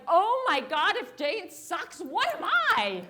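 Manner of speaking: loud, high exclamation of dismay; hammed-up alarm, rising in pitch and volume; mock panic. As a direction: wail it out in comic disbelief. Acted panic at being left behind.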